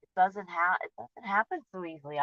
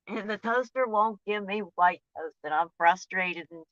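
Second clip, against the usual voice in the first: ordinary talk, nothing marked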